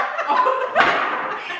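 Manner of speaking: airy wheezing giggle; breathy laugh. Real, not acted. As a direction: silent laugh.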